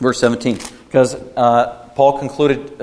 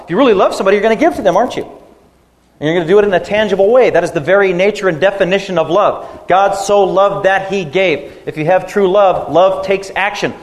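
louder, urgent sermon push; fervent preaching; 5 semitones higher, 4 dB louder than usual. Acted. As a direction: drive the point home to the congregation. Preaching peak on love.

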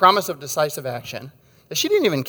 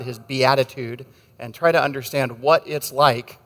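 ordinary talk, nothing marked

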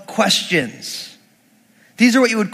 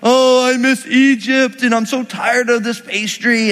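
higher-pitched mock whine; mock weeping complaint; 3 semitones higher than usual. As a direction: grumble like a homesick camp, hammed-up. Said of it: "They're all weeping in their tents".

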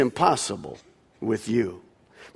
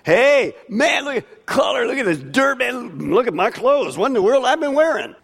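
mock excited healed man; 7 semitones higher than usual. Preacher acting out the healed blind men gawking at the world, excited and mocking. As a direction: gush like you're seeing colours for the first time. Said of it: "Notice these two guys don't go".

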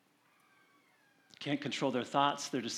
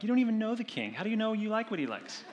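put-on childish retort; 8 semitones higher, faster than usual. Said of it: "'cause I'm like".

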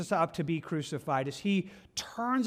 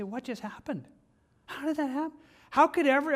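high, bewildered asking in disbelief; like a bystander who can't believe it; stunned disbelief; 8 semitones higher than usual. Retold or imitated.

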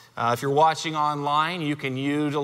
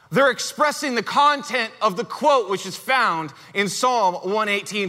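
ordinary delivery

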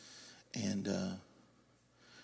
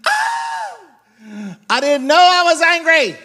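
shouted confession; 14 dB louder, much slower than usual. Real, not acted. Laughing confession to congregation.

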